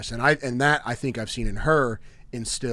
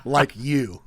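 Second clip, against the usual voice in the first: sudden shouted comeback, laughing; loud retort; 15 dB louder than usual. Retold or imitated. Wife snaps back at teasing husband, loud. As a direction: blurt it out loud and offended. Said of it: "And she said".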